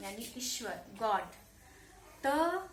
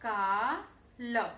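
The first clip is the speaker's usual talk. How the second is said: slow drawn-out demo; much slower than usual. Shown as a demonstration. Teacher to pupils, stretching the new word slowly. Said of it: "तो क्या बनेगा?".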